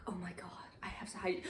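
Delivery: very soft, near-whispered worry; hushed mock alarm. Lisa hearing the family come home, whispering in alarm. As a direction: drop to a whisper as if about to be caught.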